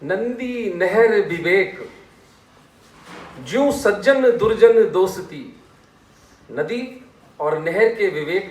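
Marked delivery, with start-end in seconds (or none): 0.0-5.6 slow, stretched verse recital; raised, measured poetic delivery; sortha recitation. Shown as a demonstration. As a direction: declaim the verse with lengthened vowels. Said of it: "इस पर एक सोरठा".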